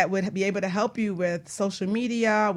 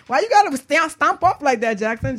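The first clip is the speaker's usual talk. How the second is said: louder, teasing call after him; playful call; 6 semitones higher, 7 dB louder than usual. Real, not acted. Host calls after co-host walking off, louder and teasing. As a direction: call out after Jackson as he stomps off.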